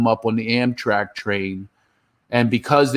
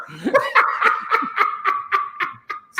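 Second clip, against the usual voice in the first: high, breathless mocking cackle; drunk cackle. Drunk friend cackling at comic, high breathless laugh. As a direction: cackle like a wasted heckler. Heckler's laugh after the taunt.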